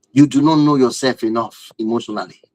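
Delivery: ordinary talk, nothing marked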